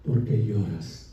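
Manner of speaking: low, drawn-out, softly voiced question; hushed gentle question. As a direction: drop suddenly low and soft, slowly. Quoting angels to weeping woman.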